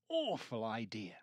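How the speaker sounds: ordinary delivery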